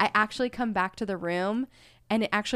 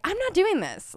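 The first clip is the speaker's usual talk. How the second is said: exasperated refusal; 9 semitones higher than usual. Retold or imitated. Fed up with messy house.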